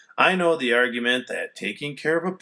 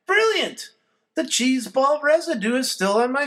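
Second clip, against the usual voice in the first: excited; 13 semitones higher than usual. A narrator's reading. Sudden triumph.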